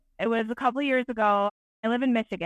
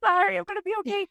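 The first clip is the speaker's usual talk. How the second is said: higher, tearful retold apology; mock tearful; 9 semitones higher, faster than usual. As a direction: imitate yourself crying it out, pitched up. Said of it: "I just started bawling".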